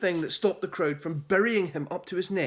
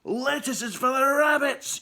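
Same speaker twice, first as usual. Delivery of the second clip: yelled; 8 semitones higher, 4 dB louder than usual. A narrator's reading. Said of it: "yelled one man".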